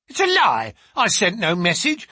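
high, loud servant's outburst; indignant denial. Acted. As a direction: throw the accusation back loudly. Accused servant denies it angrily.

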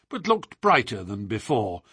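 plain reading voice

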